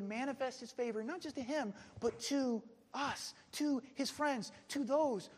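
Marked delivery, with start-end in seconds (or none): none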